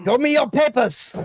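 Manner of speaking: high-pitched mock official demand; hammed-up order to a suspect; officious guard. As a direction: play a checkpoint officer for laughs. Listener mocks the challenger as officious guard.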